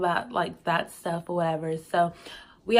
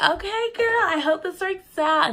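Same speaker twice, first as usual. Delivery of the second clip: put-on much higher, bubbly girlfriend voice; excited friend; 11 semitones higher, 4 dB louder than usual. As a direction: squeal it like a hyped-up best friend. Cousin voiced, hyped for her.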